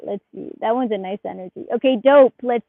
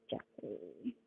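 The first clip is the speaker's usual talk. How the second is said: a near-silent, low "Jeffrey?"; hushed name; 22 dB quieter than usual. Real, not acted. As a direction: breathe the name Jeffrey lightly.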